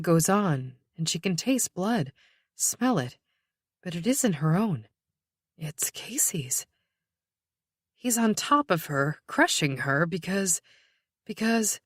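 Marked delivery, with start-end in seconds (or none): 5.6-6.7 whispered. A narrator's reading. Hushed dread in narration.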